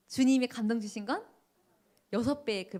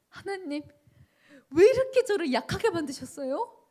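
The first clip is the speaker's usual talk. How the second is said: high, loud, tearful pleading; retelling a wailing prayer, high and loud; crying prayer; 8 semitones higher, 7 dB louder than usual. Retold or imitated. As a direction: cry out to God through sobs. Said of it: "제가 막 엉엉 울기 시작했어요".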